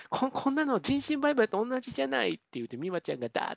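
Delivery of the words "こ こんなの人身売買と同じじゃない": high-pitched mock outrage; hammed-up objection, pitched well up; retold indignant protest. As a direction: act out an indignant heroine's protest. Retold heroine's angry protest.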